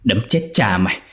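mild angry curses; old man cursing the ghost while beating her; cursing while punching. A narrator's reading. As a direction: snarl insults as you strike. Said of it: "vừa đấm vừa chửi".

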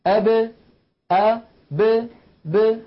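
broken-down qalqala demo. Shown as a demonstration. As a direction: let the ب of أب rebound. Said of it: "هكذا".

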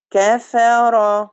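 كفر said very slowly with short open vowels; short fathas, slow. Shown as a demonstration. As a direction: spell out كفر beat by beat, no lengthening. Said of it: "لو مفتوحة". (كفر بفتحات قصيرة ببطء)

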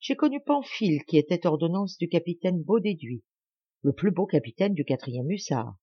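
plain reading voice

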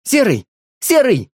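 loud, high calls after the dog. A narrator's reading. Boy shouts after the departing dog, loud high call. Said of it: "громче позвал его Женька".

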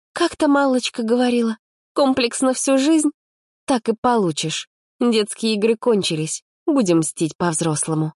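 put-on high, determined girl's voice; lightly voiced vengeful girl; a touch of scheming girl. A narrator's reading. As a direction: plot revenge. Polina plotting revenge.

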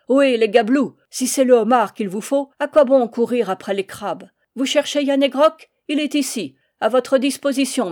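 bravade forte et haute, narquoise; défi crié. Acted. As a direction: provoquer les douaniers de loin. Le père défie les douaniers. (le père nargue les gabelous en criant)

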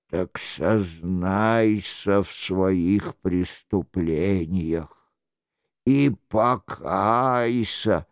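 low, slow, solemn reproach; a solemn accuser from the dark, drawn out; stern mysterious voice. Acted.

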